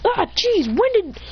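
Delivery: high-pitched acted jolt of surprise; startled. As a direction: jump as if someone popped up beside you. Character startled by sudden arrival.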